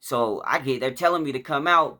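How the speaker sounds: ordinary talk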